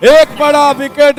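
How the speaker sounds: shouting the wicket, high and loud; excited cricket commentary. Real, not acted. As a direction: erupt as the catch is taken.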